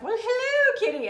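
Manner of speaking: high put-on baby-talk hello; sweet high pet voice; greeting the cat. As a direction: coo at the kitty in a high voice. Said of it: "I'm like".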